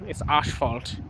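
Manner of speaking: mock German "asphalt", first vowel open as "ahsh"; playful German-accented asphalt. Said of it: "I'm saying it like a German".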